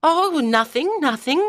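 high-pitched, falsely casual denial; forced smile. Acted. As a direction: cover up nerves with a high voice. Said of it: "trying to smile".

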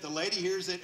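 ordinary talk, nothing marked

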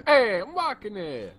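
high, hammed-up New York accent. Shown as a demonstration. Player demonstrating the accent to friends, mock New Yorker. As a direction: call it out like a cartoon New Yorker. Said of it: "everybody in New York is Joe Pesci".

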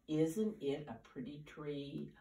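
plain reading voice

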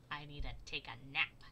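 low, drowsy voice; sleepy Badger. A narrator's reading. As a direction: sound ready to doze off. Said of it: "said Badger".

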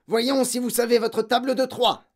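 loud, high-pitched challenge to the class; forceful, barked command; thundering. A narrator's reading. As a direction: thunder like a tyrant headmistress. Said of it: "tonna mademoiselle Legourdin".

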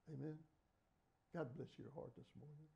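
ordinary talk

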